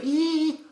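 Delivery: very high squeaked "ee"; thin little squeal held high; weird high pitch. Shown as a demonstration. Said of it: "It's just this weird pitch".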